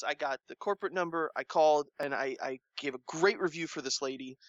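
ordinary talk, nothing marked

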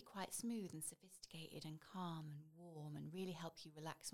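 smooth sophisticated voiceover whisper; smooth calm voice. Shown as a demonstration. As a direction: glide through it like a luxury ad. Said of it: "how you used your voice then to kind of, like, showcase that".

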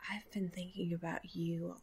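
soft, breathy, too-close whisper; creepy whisper. Pretend. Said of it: "lean over real close and you go".

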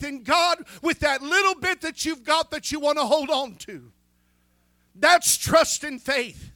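loud, rising preacher's exhortation, punching key words; full-force preaching. Acted. Pastor urging the congregation to trust God with their tithe, preaching at full force. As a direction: drive it home to the congregation like a revival sermon. Preaching about faithfulness and tithing.

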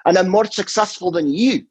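high, cheeky Glaswegian retort; mock Glaswegian local. Glaswegian heckler mocking the comedian, high mock voice. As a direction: come right back at him like a Glaswegian. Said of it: "they go".

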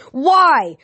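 high, loud anguished demand; demanding cry. A narrator's reading. Clarice holding the fallen girl, demanding loud and high. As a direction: demand an answer through grief. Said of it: "the real Clarice demanded".

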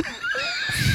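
very high shrill laugh; shrieking laugh. Real, not acted.

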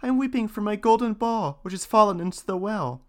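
put-on high, bright-eyed young princess. A narrator's reading. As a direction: lift it up into a girlish princess. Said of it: "said she".

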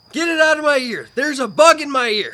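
loud, high, frantic yelling; imitated scream. Retelling the bug-in-ear freak out, screamed. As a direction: scream for help like your friend did. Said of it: "Screams it twice".

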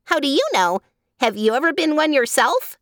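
high, cheeky monkey retort; inquiring monkey. A narrator's reading. Monkey questions the reproving girl, high and challenging.

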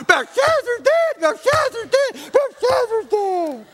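put-on high, slow wailing child's scream; little girl crying out in church. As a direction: wail it like a frightened little girl. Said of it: "she just screamed out".

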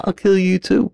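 high, thin distant threat; voice trailing off. Retold or imitated. Attacker moving on to the next car, voice trailing off. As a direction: imitate a threat fading away as he leaves. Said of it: "I hear the guy's voice trailing off".

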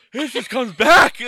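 high, loud, drawn-out wail; pained cry. Real, not acted. Podcaster as hot sauce burn comes back, loud pained wail.

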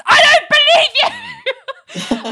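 high, loud, very slow scream breaking into a laugh. Retold or imitated. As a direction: scream it at her, over the top. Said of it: "Richard just screams".

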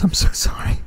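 whispered through tears; quietly weeping. Acted. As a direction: breathe it out, crying softly. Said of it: "quietly weeping".

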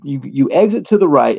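plain reading voice

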